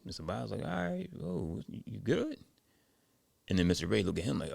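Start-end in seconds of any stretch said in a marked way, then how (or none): 0.6-2.4 high-pitched, tentative check-in; mildly puzzled. Retold or imitated. Sabata checks on Mister Ray, mildly puzzled and higher. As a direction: lift it high into a question.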